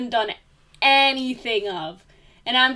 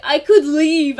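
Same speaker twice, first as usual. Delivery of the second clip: huffy threat; 5 semitones higher, 8 dB louder than usual. Retold or imitated. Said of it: "and then she's like".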